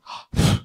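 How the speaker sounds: short imitated gasp; shocked gasp. Salesman reacting to a customer's refusal, imitated gasp. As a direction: gasp as the offended salesman would. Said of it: "He's like this".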